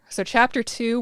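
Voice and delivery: ordinary delivery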